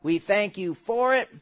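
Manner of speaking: closing prayer. Acted.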